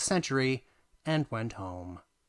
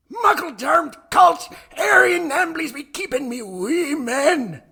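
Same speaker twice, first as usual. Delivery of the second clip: furious, slow Scottish shout, much higher and louder; Old Man Henderson's Scottish yell; 11 dB louder, slower than usual. Acted. Old man demanding his lawn gnomes back, in a screaming Scottish accent. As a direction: roar it in a thick Scottish accent, crying out. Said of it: "nigh incomprehensible Scottish accent".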